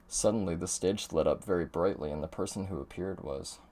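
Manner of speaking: low, soft storyteller read; game narration. Acted. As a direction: build quiet suspense toward a reveal.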